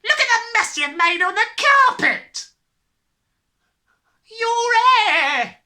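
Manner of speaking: shrill, high shrieking mother. A narrator's reading. Mother horrified at the mess and the hair, shrieking. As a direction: shriek it at the top of your voice. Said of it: "the mother was shrieking".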